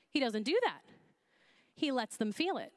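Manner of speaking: ordinary talk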